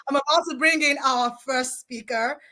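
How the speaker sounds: ordinary talk, nothing marked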